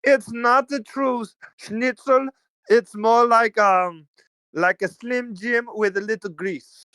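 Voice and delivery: high, slow put-on snooty voice; mock snooty German. Imagining a German tasting Conecuh sausage, high snooty put-on voice. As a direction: play a sniffy German food critic. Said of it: "they'd be like".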